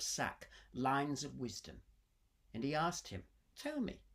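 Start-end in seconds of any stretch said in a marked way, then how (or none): 3.5-4.0 higher, commanding king. Acted. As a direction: ask as an eager king. King asks the sage.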